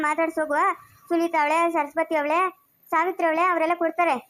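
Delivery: ordinary delivery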